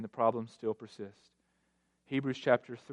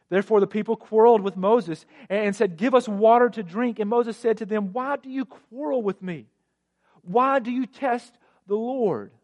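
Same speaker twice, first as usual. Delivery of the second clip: raised, much higher voice for the quarrel; heated scripture reading; 9 semitones higher, 9 dB louder than usual. A narrator's reading. Preacher reads Moses confronting quarreling people, heated reading. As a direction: read the dispute out loud and high, indignant.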